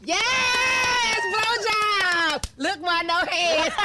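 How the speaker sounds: high, loud victory yell; triumphant shout. Real, not acted. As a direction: cheer it out after the shot. Triumph after the shot.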